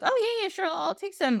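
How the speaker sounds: eager little man. Acted. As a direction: squeak out a happy yes. Keg carrier answers Rip eagerly.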